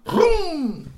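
high loud engine noise; car vroom. Acted. Narrator voicing the car speeding off, loud. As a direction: roar like a car.

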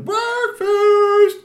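very high, loud sung-out call, slow at the end; sing-song holler down the hall; yelling breakfast. Acted. Sisters calling the kids to breakfast, loud high sing-song. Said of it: "the aunties came in yelling".

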